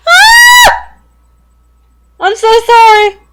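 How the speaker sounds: shrieking very high and loud, then a panicked apology; terrified scream. Real, not acted. Player startled by the furnace, screaming apology. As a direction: scream in real fright. Jump scare, furnace kicks on.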